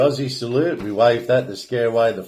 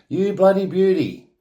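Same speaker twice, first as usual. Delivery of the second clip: Aussie cheer; 7 semitones higher than usual. Shown as a demonstration. Explaining slang to viewers, a pitched-up Aussie cheer. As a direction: cheer it like a mate winning. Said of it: "most often explained as".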